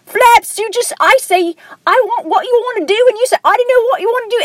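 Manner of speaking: very high, hammed-up [name] accent; bickering cartoon vulture in a squeaky-high [name] accent. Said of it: "like, a [name] accent".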